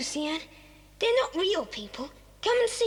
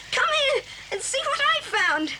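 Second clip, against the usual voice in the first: high, eager call to companions; raised voice, full of discovery; excited calling; 7 semitones higher than usual. Acted. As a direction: summon the others to a thrilling find. Calls others to a discovery.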